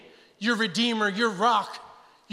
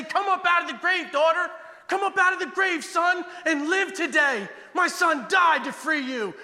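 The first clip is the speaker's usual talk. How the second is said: a hint of a higher calling voice; voice of the King; 9 semitones higher than usual. The King calling his children up, lightly voiced. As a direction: call them up out of the grave. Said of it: "And hear him say".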